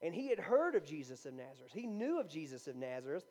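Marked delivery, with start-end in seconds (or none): none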